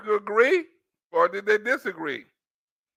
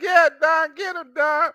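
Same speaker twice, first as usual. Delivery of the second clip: imitated cheering; 6 semitones higher, slower than usual. Acts out fans cheering Don on.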